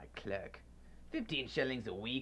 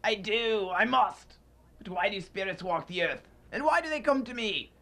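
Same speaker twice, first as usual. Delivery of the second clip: much higher, louder desperate pleading; frantic plea; 9 semitones higher, 5 dB louder than usual. Acted. Scrooge giving in, begging the ghost in panic. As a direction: give in, voice rising in panic.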